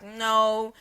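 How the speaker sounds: normal talking voice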